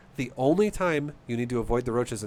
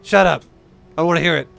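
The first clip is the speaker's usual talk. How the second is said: louder, higher "Shut up" snapped at himself; loud self-scold; 3 semitones higher, 8 dB louder than usual. Real, not acted. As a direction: cut off his own bad joke. Telling himself off loudly.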